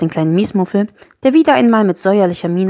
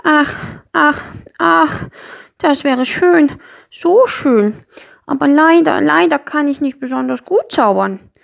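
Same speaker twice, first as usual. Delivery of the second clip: high, plaintive little creature; dejected; 8 semitones higher, 3 dB louder, slower than usual. A narrator's reading. Miesmuffel confesses he is bad at magic, dejected. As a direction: lament it wistfully, sigh after sigh. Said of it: "sagte der kleine Miesmuffel betrübt".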